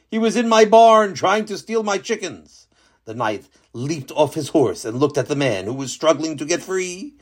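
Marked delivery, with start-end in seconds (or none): 0.1-2.7 farmer's voice. Acted. Farmer explains capture.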